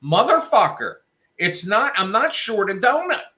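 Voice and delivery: high, loud exasperated rant; retold angry outburst. Cursing out Dunkin' over the missing donut, angry. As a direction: tell them off, fed up. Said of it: "And I said".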